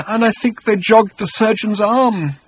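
high, slow tearful wail; crying. A narrator's reading. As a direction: draw it out as if in tears. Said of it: "He began to cry again".

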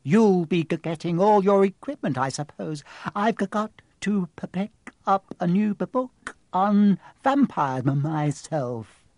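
stuttering, jittery timid voice; frightened stammer. Acted. Quirrell dreading his vampire book, stammering. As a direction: stumble on nearly every word.

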